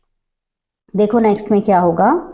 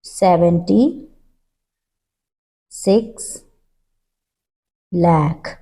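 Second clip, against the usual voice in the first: slow dictation; 3 semitones lower, much slower than usual. Shown as a demonstration. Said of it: "teacher dictates slowly, word by word".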